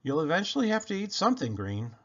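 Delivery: plain reading voice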